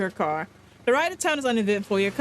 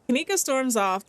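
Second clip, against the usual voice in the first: ordinary delivery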